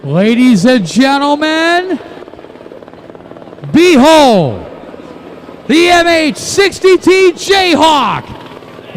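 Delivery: slow, grand announcer reveal; showing off. Acted. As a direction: present it like a showpiece. Announcer presenting the helicopter.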